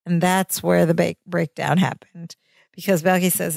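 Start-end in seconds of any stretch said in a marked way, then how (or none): none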